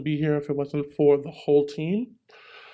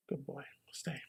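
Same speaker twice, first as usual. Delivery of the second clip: whispered command to dog; 2 semitones lower, 19 dB quieter than usual. Real, not acted. A speaker at the podium settling his guide dog, whispered. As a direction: hush it so only the dog hears.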